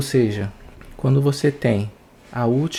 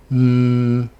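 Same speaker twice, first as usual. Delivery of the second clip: long, louder humming "nnn"; voiced N hum; 6 dB louder than usual. Shown as a demonstration. Teacher asking which sound vibrates, sustained voiced hum. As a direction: sustain a buzzing N on the vocal cords. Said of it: "Qual dos dois vibra?".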